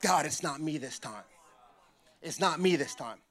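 low, soft, confessional murmur; hushed relieved prayer. Retold or imitated. Frightened child thanking God it's not him, hushed prayer. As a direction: pray it privately, pitched well down.

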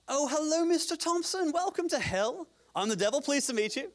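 put-on high-pitched, jokey English-accented devil; hammed-up, wobbly English accent; mock English accent. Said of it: "I don't know what that accent is. I think it's English".

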